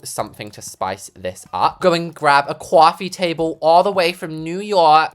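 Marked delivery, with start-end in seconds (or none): 1.8-5.1 loud, hammed-up New York accent; bad New York accent. Shown as a demonstration. Builder fetching a coffee table, failed New York accent. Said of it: "I cannot do a New York accent".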